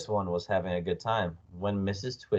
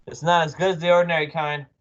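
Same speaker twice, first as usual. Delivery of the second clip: talking through food, a bit quick; mouth full; 7 semitones higher, 4 dB louder, faster than usual. A narrator's reading. Husband eating worm spaghetti, mouth full. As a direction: speak higher through a mouthful. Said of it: "talking with his mouth full".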